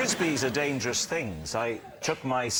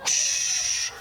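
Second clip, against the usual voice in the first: whooshing flight sound; a long unvoiced "shh" of something flying; imitated whoosh. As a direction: imitate a frisbee whizzing through the air. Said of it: "and it went".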